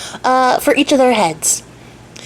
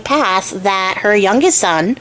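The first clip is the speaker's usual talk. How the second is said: ordinary delivery, nothing marked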